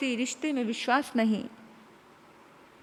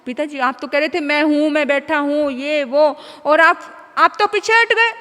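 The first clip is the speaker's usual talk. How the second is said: loud accusing cry, rising to a high shout; injured son shouting reproach; hurt yell; 3 semitones higher, 9 dB louder than usual. Acted. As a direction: yell at father after falling. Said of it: "बेटा चिल्लाया".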